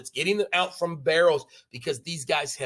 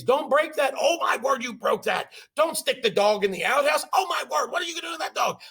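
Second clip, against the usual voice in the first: high, fretting mother at a frat party; fussing mom; 8 semitones higher than usual. Retold or imitated. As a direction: flap around like a worried mom. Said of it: "like a mom at a fraternity party".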